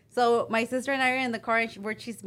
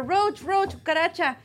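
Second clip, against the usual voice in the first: louder, higher urgent call; retold crowd warning; 7 semitones higher, 4 dB louder than usual. Retelling fans warning singer about the roach, raised imitating calls. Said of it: "they're telling her".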